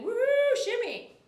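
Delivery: a quick high cheer for a helper. Real, not acted. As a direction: whoop it up for her. Cheering a moderator on stream.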